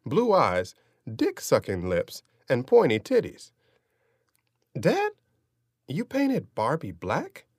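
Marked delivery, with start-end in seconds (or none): 4.8-7.5 put-on high, slow, incredulous kid; young boy voice. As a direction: ask it like a stunned little boy. Boy questions his father.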